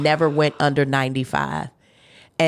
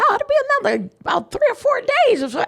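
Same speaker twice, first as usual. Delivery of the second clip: a higher, quicker, breezy doctor voice; doctor's voice; faster than usual. Retold or imitated. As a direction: rattle it off lightly like the doctor. Doctor announcing the longer stay.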